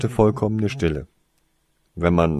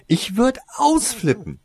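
much higher, louder exasperated line; irritated outburst; 14 semitones higher, 5 dB louder than usual. Acted. Winona tells Toriesa she would freak out, irritated. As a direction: let the irritation spill over. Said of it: "Das Mädchen klang wirklich sehr gereizt".

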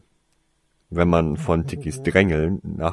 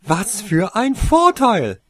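higher, louder, slow and pointed; indignant question; 12 semitones higher, 8 dB louder, slower than usual. Acted. Winona demands what advantage Toriesa means, annoyed. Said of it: "fragte Winona immer noch ungehalten".

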